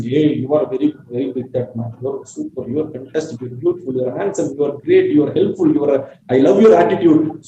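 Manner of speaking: mock flattery. Mock flattery to impress someone.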